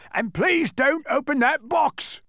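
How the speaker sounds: high, loud, drawn-out plea; terrified giant wailing a plea; panicked pleading. A narrator's reading. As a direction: beg slowly in a terrified high voice. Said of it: "he pleaded".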